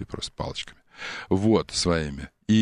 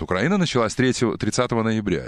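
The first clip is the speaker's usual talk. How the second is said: normal talking voice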